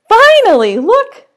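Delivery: high, loud "finally, look"; bursting with anticipation; announcing the hatching to kids; excited. Acted. Excited reveal.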